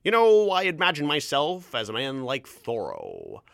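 put-on drawn-out rural Ontario farm accent. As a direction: play a one-man-show farmer with a broad Ontario accent. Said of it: "that was from the original ... Wingfield Farm".

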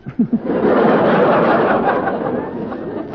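high breathy laugh. Real, not acted. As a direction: break up laughing. Laughs at the joke.

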